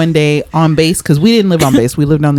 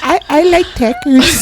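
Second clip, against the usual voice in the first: put-on high-pitched botched American accent; mock American accent; 11 semitones higher than usual. Retelling the British girl's answer, badly faked American accent. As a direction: imitate a British kid faking an American accent. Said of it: "trying to fit in and have an American accent".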